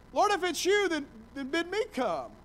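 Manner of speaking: lightly hammed-up stagey plea; theatrical Shakespearean. Peter calling to Jesus on the water, mildly theatrical. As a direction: Peter asking with a hint of stage grandeur. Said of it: "my best theatrical Shakespearean".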